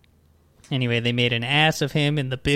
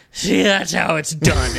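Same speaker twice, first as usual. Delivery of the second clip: mock out of breath; 3 semitones higher, 5 dB louder, slower than usual. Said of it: "be so out of breath, and be like".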